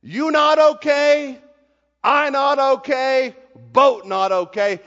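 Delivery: high, loud, slow broken-English rant; angry boatman. Retold or imitated. The boatman furious at the teacher, slow, loud and high. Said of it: "and said".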